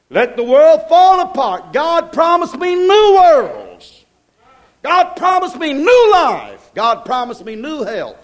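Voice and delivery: high, loud and slow, stretching each promise; full-force preaching. Acted. Preacher declaring God's promises to the church, slow ringing proclamation. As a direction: proclaim it slowly to the rafters.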